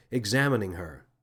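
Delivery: usual speaking voice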